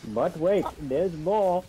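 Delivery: loud, high TV salesman hype; infomercial announcer. Pretend. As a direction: sell it like a shopping channel host. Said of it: "you've gotta do the whole as seen on T V".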